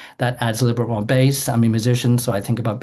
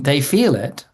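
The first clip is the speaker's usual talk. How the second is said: ordinary talk, nothing marked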